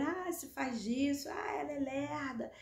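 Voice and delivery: light mock-disparaging voice; mocking critics. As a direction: imitate people labelling her. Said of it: "às vezes eu sou taxada muito disso".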